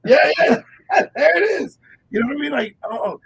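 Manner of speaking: high, loud laughing yeahs. Real, not acted. Laughing along with the radio cohost, high and loud.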